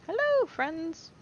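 high, slow, drawn-out hello; sing-song greeting. Acted. Player sweetly greeting creatures he wants to befriend.

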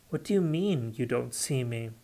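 usual speaking voice

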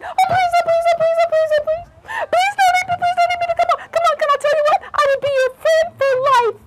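very high, squeaky begging; pleading little mouse. Acted. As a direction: beg rapidly in a tiny mouse voice. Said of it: "And little mouse said".

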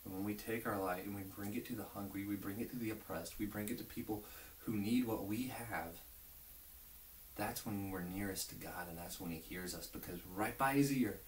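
normal talking voice

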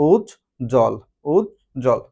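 উজ্জ্বল in two separated parts, "uj, jol", no b sound; silent-ব pronunciation. Shown as a demonstration. Teacher showing that ব is not pronounced, উজ্জ্বল split as "uj-jol". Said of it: "বয়ের উচ্চারণটা আসতেছে না".

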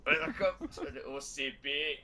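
hammed-up Glaswegian announcer; Scottish accent. Shown as a demonstration. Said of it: "Glaswegian kind of accent".

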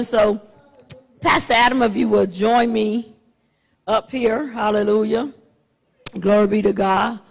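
normal talking voice

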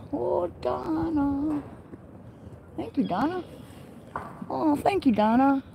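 high, soft pet-talk; soft, high, adoring voice; tender cooing. Real, not acted. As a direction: coo gratefully to a beloved animal. Cooing to an affectionate goat.

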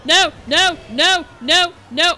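loud, high, repeated cries; panicked shouting. Real, not acted. Player seeing a red shell coming, shouting in panic. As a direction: shout each no in alarm.

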